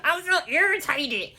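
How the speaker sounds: high put-on irritated voice; playful mock irritation. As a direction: repeat it as a high, hammed-up imitation. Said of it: "that's my [name] voice".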